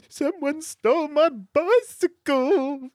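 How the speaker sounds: put-on high, slow blubbering Australian boy; crying boy. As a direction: wail it like a little kid who lost his bike. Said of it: "Crying in accent is hard".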